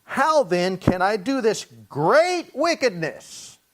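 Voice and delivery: slow, emphatic question landing on "great wickedness"; stress on GREAT WICKEDNESS. Acted. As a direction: lean hard and slowly on "great wickedness". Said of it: "he puts the right emphasis on the action".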